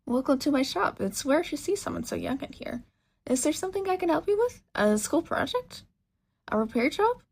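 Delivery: high, excited woman's voice; bubbly shopkeeper. Acted. Mechanic woman welcomes a young customer, bubbly and high. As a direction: gush at the customer eagerly.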